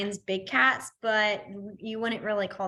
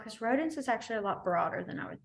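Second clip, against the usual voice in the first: whisper; 7 dB quieter than usual. Real, not acted. Aside to herself.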